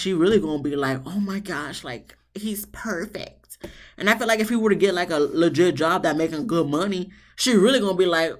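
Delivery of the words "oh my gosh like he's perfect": soft, slow, dreamy mock gush; swooning wife. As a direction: gush like a smitten newlywed, hushed. Mimicking smitten wife.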